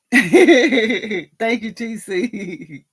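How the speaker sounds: thanks bubbling out through laughter; laughing thanks. Real, not acted. Host thanking T C, laughing.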